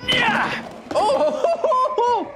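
delighted yell. Real, not acted. Gamer cheering a brutal kill, high delighted yell. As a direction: cheer the kill at the top of your voice.

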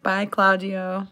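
ordinary delivery

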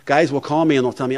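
usual speaking voice

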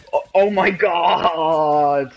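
long, drawn-out loud cry of disbelief; slow, stretched-out exclamation, really loud; loud exasperated outcry. Real, not acted. Gamer reacting to a missed kill, loud exasperated outcry.

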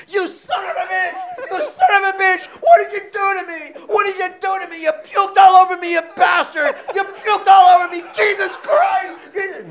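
hammed-up furious outburst, partly laughing; retold furious scream. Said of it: "started screaming".

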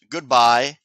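lightly croaked storybook goodbye. A narrator's reading. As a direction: call a goodbye as a talking frog. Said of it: "and croaked".

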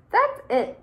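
high, loud eureka; excited exclaim. Acted. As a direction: suddenly get the idea. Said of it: "exclaimed Snippet".

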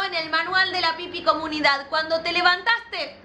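usual speaking voice

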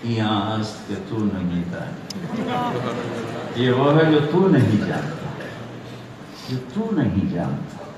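innocent. Retold or imitated. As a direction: turn the words back on him guilelessly. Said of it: "بڑی معصومیت سے بولے". (معصومیت بھرا لہجہ)

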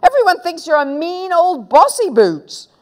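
high, loud taunt; sour yell. A narrator's reading. Girl calling her friend bossy boots, sour high yell. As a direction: throw the insult high and loud. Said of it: "yelled Susan sourly".

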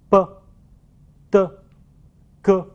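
b, d, g as unvoiced French p, t, k; short separate bursts on b, d, g without buzzing vocal cords; voiceless consonants. Shown as a demonstration. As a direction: say b, d, g crisply as p, t, k. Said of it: "清辅音的特点是发音的时候声带是不震动".

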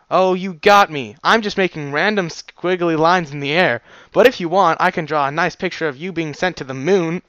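sarcastic reply. Acted. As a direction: lay the sarcasm on thick. Sarcastic voiced written reply.

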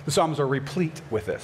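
ordinary talk, nothing marked

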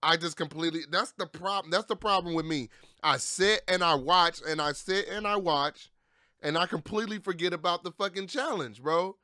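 ordinary talk

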